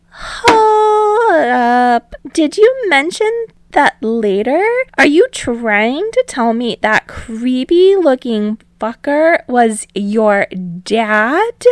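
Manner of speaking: put-on high, loud disbelieving girl voice; loud, high indignant outburst; shocked girl. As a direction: Mina can't believe what she just heard. Mina shocked at the table.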